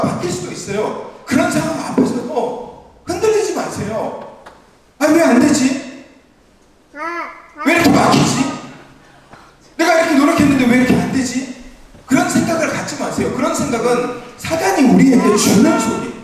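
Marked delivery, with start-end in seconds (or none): 5.0-11.6 higher, whiny questioning of a stuck believer; frustrated complaint. Acted. Preacher voicing a frustrated believer, mock complaint. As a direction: sigh it out like someone whose prayers fail.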